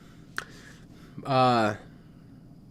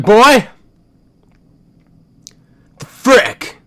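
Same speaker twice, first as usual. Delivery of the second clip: loud, higher angry bark of a father; dad yelling; 7 semitones higher, 8 dB louder, slower than usual. Retold or imitated. Dad confronting his son at the wall, loud and exasperated. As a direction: blast it at the kid on the floor. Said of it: "sometimes when he yells".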